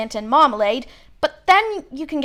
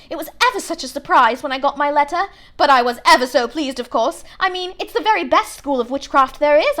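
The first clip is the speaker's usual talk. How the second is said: rapid, louder, bossy chatter; very fast; 4 semitones higher, 4 dB louder than usual. Acted. Know-it-all girl lectures the boys, fast and bossy. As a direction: rattle it off without a breath. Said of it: "She said all this very fast".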